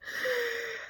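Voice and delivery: sharp high gasp of amazement; astonished gasp. Retold or imitated. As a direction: gasp in disbelief. Walked out stunned.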